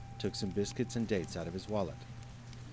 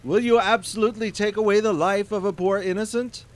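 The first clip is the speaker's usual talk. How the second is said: desperate plea; 12 semitones higher, 11 dB louder than usual. Acted. Merchant pleads desperately.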